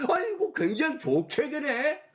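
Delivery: high, drawn-out feigned admiration; mock delight. Teacher scoffing at an absurd exam option, mock delighted. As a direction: marvel slowly with put-on delight.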